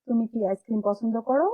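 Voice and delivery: ordinary delivery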